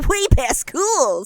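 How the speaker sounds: lightly voiced Sonic catchphrase; Sonic impression. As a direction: toss it off like Sonic. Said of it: "my Sonic impression".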